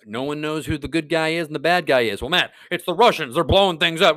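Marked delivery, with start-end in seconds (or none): 2.2-4.2 1 mock viewer. Imagined viewer objects to Matt.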